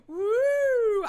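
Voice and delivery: high loud whoop. Real, not acted. Whooping at viewers after the laughing fit. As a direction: let out a big whoop.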